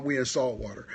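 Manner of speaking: normal talking voice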